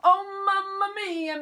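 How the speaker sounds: loud, high, drawn-out exasperated cry; Italian shopkeeper's big shout. Acted. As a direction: bellow "mamma mia" slowly. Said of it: "Mister Angelini shouts".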